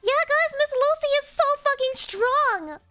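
high, loud fangirl squeals; squealing cheer. Acted. Streamer voicing excited fans cheering for Miss Lucy, high squeals.

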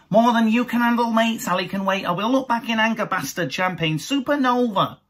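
swagger like a Britpop frontman; mock [name] swagger. Many women in there, cocky mock lad voice. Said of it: "How many women are in there".

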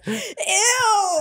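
high, drawn-out laughing ew; mock disgust. Boy reacts to the video, mock disgust. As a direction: stretch it high, recoiling in exaggerated revulsion.

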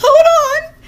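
very high, loud squeal; frantic shout. Retold or imitated. To the clerk, a frantic high shout. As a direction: screech it in a frenzy.

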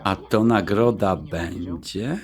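soft, slow teasing murmur; hint at a reward, softly; quiet, slow flirting between engaged couple; flirty murmur. Acted.

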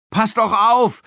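high, loud urgent yell; shouted warning. A narrator's reading. Tina to Erik with the bull behind him, shouted warning. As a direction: yell to make him take notice. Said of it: "rief Tina abermals".

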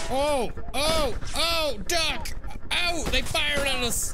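high, loud panicked shouts; panicked yelling. Real, not acted. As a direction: yell in real panic. Being shot at in game.

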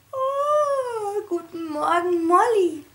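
high, sleepy, smiling good-morning yawn; yawning happy piglet. A narrator's reading. Piglet Mona yawns good morning to the dog, smiling. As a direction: stretch the greeting out in a cheerful yawn. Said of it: "gähnte Mona mit einem Lächeln".

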